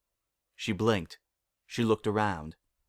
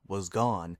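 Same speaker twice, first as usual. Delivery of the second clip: usual speaking voice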